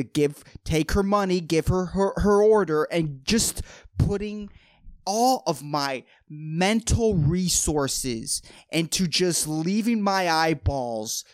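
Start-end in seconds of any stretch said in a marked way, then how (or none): none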